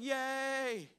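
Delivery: high little mock cheer. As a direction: cheer like the crowd. Crowd cheering at good report.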